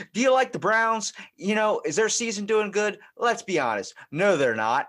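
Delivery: high, loud imitation of an ad presenter; mock commercial pitch. Saleswoman in commercial ribbing Browns fans, imitated loud pitch.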